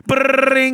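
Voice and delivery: high loud brrring; phone ring. Retold or imitated. Imitating the phone ringing, movie-style.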